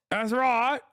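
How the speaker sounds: high, exaggerated British voice; hammed-up British drawn-out vowels; mock British accent. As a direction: pitch it up like a caricature Brit. Back into the British caricature.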